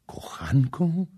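breathless whispered echo; shocked whisper. A narrator's reading. Kama echoing the prince's word, a stunned whisper. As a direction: whisper the word back in disbelief.

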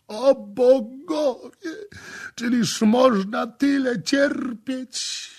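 very high, loud, slow wail; tormented cry. A narrator's reading. Victim answering from underground, agonized wail. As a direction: cry out in agony to the gods. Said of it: "odpowiedziano z podziemi".

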